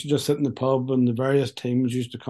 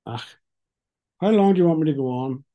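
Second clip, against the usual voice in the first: ordinary talk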